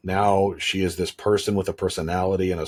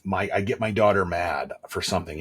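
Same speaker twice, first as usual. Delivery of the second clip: ordinary talk, nothing marked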